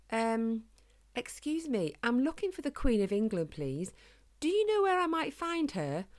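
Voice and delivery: high-pitched, polite little hedgehog. A narrator's reading. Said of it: "ever so politely".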